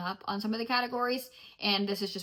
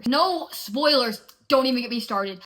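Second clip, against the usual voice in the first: loud protest; 2 semitones higher, 8 dB louder than usual. Real, not acted. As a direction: plead loudly, hands over ears. Pleading against spoilers.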